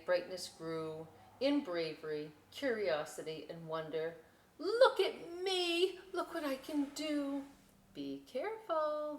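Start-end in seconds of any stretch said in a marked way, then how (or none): 4.6-7.4 excited shout. Acted. Little boat showing off to the lighthouse, high and gleeful. 7.9-9.2 calling out. Acted. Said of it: "the lighthouse called".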